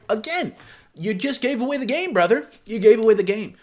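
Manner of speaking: you caught him, say it twice, loud; exasperated call-out. Real, not acted. Vlogger calling out the texter, loud and exasperated.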